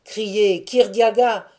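usual speaking voice

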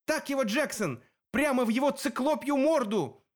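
high-pitched cheering from afar; shouted cheer. A narrator's reading. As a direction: egg on a fighter, pitch way up. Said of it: "Клариса на палубе закричала".

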